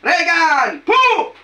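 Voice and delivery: high, drawn-out commanding call; exorcism cry. Real, not acted. Healer calling out to expel the spirit, high commanding cry. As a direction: cry out with a sudden high burst. Exorcist calls out, then blows.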